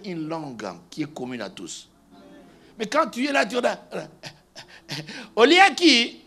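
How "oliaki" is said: loud, high mock call; acted calling out. Preacher acting someone speaking Lingala in a mixed church, calling out.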